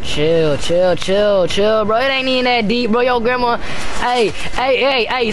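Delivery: yelling in a panic; panicked yelling. Real, not acted. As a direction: shout it at full volume. Panicked yelling during fight.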